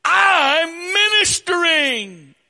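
high, loud shouted whining; whiny accusation. Retold or imitated. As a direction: wail it like a wounded martyr. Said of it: "her accusation".

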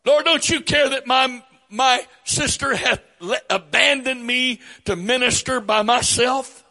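high, loud whiny complaint; complaining Martha. Retold or imitated. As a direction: whine like a put-upon sister. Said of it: "Martha uses the word serve".

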